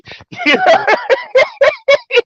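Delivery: long, high-pitched laugh; real squealing laughter. Real, not acted. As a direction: crack up at your own joke. Laughs at own slangy compliment.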